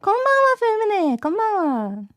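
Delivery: high, bright Japanese hello; excited greeting. Real, not acted. Streamer greeting Fumine, excited and high. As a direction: sing out the greeting.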